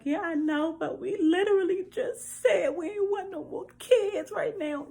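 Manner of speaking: high, tearful voice breaking into a laugh-cry; crying to friend. Retold or imitated. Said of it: "Y'all, I am broken on the inside".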